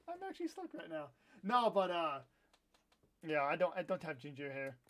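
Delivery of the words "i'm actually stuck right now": high, soft, helpless laughter through the words; laughing. Real, not acted. Streamer stuck in the game, laughing.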